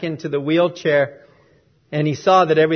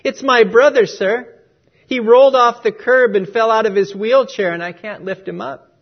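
put-on tearful boy; 5 semitones higher, 6 dB louder than usual. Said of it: "With tears dripping down his face".

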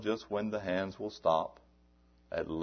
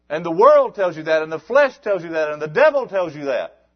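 high, loud fervent preaching; fired-up revival preacher; preaching at full force; 11 semitones higher, 15 dB louder than usual. Acted. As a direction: build the congregation up.

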